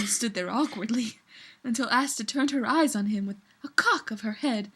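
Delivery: ordinary talk, nothing marked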